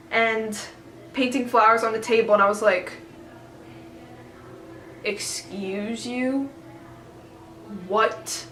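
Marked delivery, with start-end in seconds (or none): 5.0-8.5 slow, hushed excuse you; affronted disbelief. Retold or imitated. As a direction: draw it out softly in stunned offence. Affronted reaction to the painting story.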